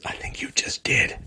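whispered snarky aside; sly whisper. Real, not acted. Mocking the columnist who just brought it up, whispered. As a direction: whisper it as a gotcha.

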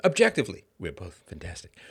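ordinary talk, nothing marked

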